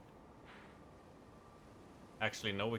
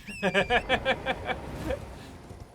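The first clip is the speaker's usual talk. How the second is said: laughing; 10 semitones higher than usual. Real, not acted. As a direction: burst out laughing. Laughs after mock outburst.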